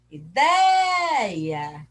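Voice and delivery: DEI in "Ideia" stretched long and loud; calling from afar. Shown as a demonstration. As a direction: call "Ideia" into the distance, holding DEI. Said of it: "Vamos chamar longe".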